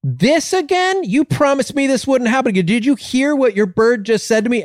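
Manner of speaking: high-pitched indignant customer; very upset. Acted. Customer complaining about the bird a second time, high outraged protest. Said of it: "the guy gets very upset".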